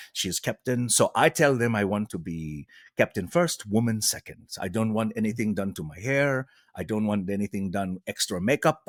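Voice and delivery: put-on measured, earnest French Canadian accent. Actress explaining her terms for the role, French Canadian accent. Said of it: "her French Canadian accent".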